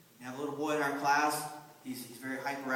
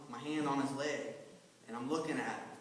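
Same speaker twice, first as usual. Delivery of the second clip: normal talking voice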